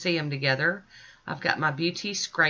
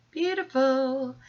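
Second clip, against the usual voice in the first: admiring; 10 semitones higher, slower than usual. Real, not acted. Admiring the polish color.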